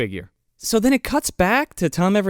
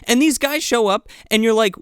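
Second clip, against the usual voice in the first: ordinary talk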